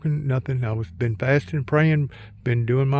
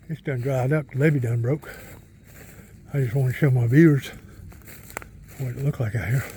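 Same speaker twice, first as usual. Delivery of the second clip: ordinary delivery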